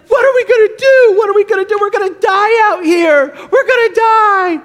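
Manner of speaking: high, loud panicked cries; hammed-up panic, wailing over the storm; acted terror. Disciples in a boat in a huge storm, acted terror. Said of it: "Jesus' disciples are terrified".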